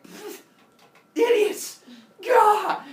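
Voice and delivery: high, loud mock alarm; exasperated outburst. Prometheus sensing humans' foolishness, exasperated outburst. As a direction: burst out at the idiots. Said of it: "his idiot alarm goes off".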